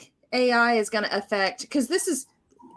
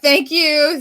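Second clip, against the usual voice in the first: louder, higher gushing thank you; mock grateful thanks; 7 semitones higher, 9 dB louder than usual. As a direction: gush thanks like a relieved salesperson. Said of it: "They were like".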